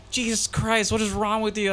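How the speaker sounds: high mock exasperation; exasperated director. Director scolding him, high mock exasperation. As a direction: throw up your hands like a director.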